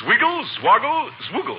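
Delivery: magic incantation. Acted. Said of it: "rub it three times and say".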